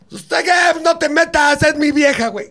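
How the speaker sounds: high, loud mock aggressor yelling back; aggressive boyfriend. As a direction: bark it like a jealous boyfriend picking a fight. Aggressor's retort in the fight.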